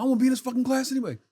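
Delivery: put-on angry teen. Storming out of class.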